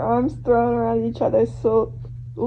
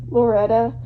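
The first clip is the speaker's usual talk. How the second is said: ordinary delivery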